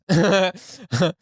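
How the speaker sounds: a short burst of high laughter; real laugh. Real, not acted. Reacting to a diss lyric, bursting into a real laugh.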